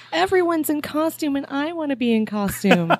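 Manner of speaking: put-on high, whiny toddler voice; whiny baby voice. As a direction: whine it like a pouting child. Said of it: "I'm like".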